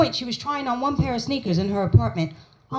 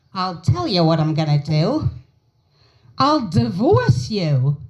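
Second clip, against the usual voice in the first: deadpan threat; 4 semitones lower than usual. Retold or imitated. Mother's threat.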